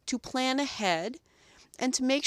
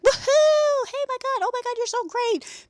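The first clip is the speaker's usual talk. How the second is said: very high, loud whoop of praise; overexcited cheer; 6 dB louder than usual. Shown as a demonstration. What not to do: groomer whooping loudly at a dog in a group room. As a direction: burst out like a thrilled cheerleader. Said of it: "I can't just be like".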